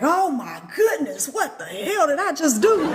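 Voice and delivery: high, slow shocked mock panic; overwhelmed visitor. A visitor stunned after meeting the spirit, mock shock. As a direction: gasp it out in mock alarm.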